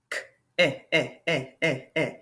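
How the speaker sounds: K, then short "eh" repeated in separate beats; sounding out. Shown as a demonstration. Teacher showing a short vowel sound, sounding it out. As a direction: isolate and repeat the "eh". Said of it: "That's a short vowel sound".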